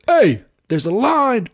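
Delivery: high, loud and drawn out; slow high mock call-out; retold call-out. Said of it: "Yeah, I said".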